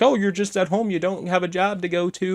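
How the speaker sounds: high, sneering mock voice of someone dismissing stay-at-home moms; mocking dismissive critic. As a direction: pitch it way up and scoff at her exhaustion. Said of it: "You're like".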